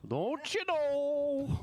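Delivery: slow drawn-out northern Minnesota "Don't you know"; Fargo accent. Retold or imitated. Host teasing a northern accent: slow Fargo accent. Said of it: "Like Fargo?".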